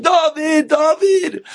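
high mock scream of a name; father hollering to wake his kid; acted high-pitched call. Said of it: "and he's screaming".

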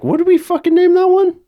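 very high, loud mock bewilderment; high-pitched hammed-up confusion; baffled parent, acted. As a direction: play an exasperated parent blanking on a name. Acts out parent forgetting child's name.

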